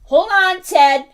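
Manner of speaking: loud, high call to a pet. Real, not acted. Owner tells dog ringing the bells to wait, calling out.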